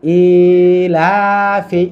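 ইলাফি very slow, with both the ই and the লা drawn long; recitation demo. Shown as a demonstration. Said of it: "ইতেও টান, লাতেও টান".

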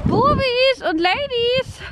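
slow, high sing-song hello; sing-song greeting. Real, not acted. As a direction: greet the animals in a stretched, lilting voice. (langgezogener Singsang)

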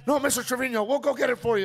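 high, loud eager staffer; insistent runner. Retold or imitated. Runner insists on fetching it, high and loud.

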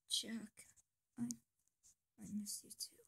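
soft, slow whispered; tender whisper. Acted. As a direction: breathe it tenderly.

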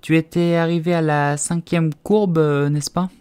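put-on slow, high, tentative girl voice; drawn-out, uncertain recollection; hesitant guess. A narrator's reading. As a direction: feel your way to an answer. Alice hesitantly guesses, placating mouse.

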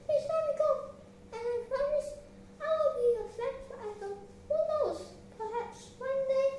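high-pitched little mouse pleading for its life; frightened high mouse voice, begging. Acted. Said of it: "while begging the lion".

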